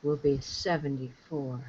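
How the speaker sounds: low, soft sultry voice; sexy voice. Pretend. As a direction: purr it seductively. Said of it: "sexy voice".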